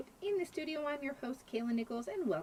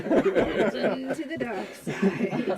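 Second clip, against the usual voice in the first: laughing dramatic; 9 dB louder, much slower than usual. Acted. Joke about dark side.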